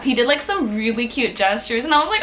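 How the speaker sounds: ordinary talk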